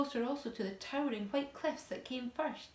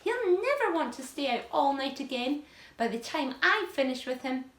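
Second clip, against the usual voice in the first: menacing aunt voice; 4 semitones higher, 7 dB louder than usual. Acted. Aunt threatening the boy.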